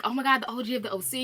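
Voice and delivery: fast starstruck gush; fangirling. Retold or imitated. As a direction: rush it out like a fan meeting her idol. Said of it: "She's like".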